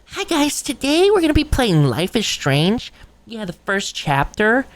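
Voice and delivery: high, dainty streamer intro voice; cozy gamer. Acted. Cozy gamer opening a Life is Strange video, high put-on voice. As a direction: greet viewers in a put-on high-pitched voice. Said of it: "some cozy gamer was like".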